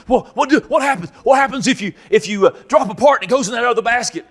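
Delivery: high, fast, jittery questioning; eager trainee. Retold or imitated. The strung-out trainee asking about the basket, high and jittery. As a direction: rush the question like a wired kid.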